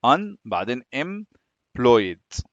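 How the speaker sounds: slow "un", pause, then "employed" in pieces; split into parts. Shown as a demonstration. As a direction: divide "unemployed" slowly into its parts for learners. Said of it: "نقسمها".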